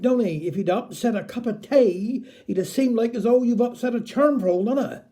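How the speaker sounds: ordinary delivery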